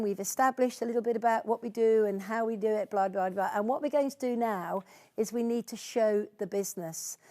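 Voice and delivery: ordinary talk, nothing marked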